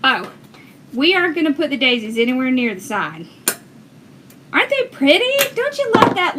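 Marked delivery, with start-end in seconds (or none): 4.5-6.4 gushing delight. Real, not acted. Gushing over daisies to viewers.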